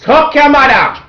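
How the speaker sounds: sudden high, loud yell; hammed-up shouted insult; mock outburst. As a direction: blurt it out like cursing the boss. Outburst after reading resignation letter.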